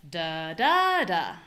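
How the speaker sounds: weak, STRONG, weak; strong middle da. Shown as a demonstration. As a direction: punch the middle da. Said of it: "a stress pattern like".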